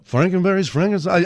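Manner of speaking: a cheesy Seinfeld bit; bad Jerry Seinfeld. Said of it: "I started doing, like, a bad, uh, Jerry Seinfeld".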